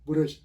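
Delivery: "ब्रश" split as bu-RUSH; local mispronunciation. Shown as a demonstration. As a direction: slip a u after the b of ब्रश. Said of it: "हम बोलते भी ऐसा ही है".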